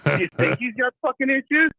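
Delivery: mock him with disbelief; sarcastic. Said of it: "I said".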